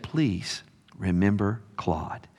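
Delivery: gently asking the church to pray for her husband; soft loving plea. Retold or imitated. Wife softly asking the church to pray for Claude, tender. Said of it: "with all the love and respect".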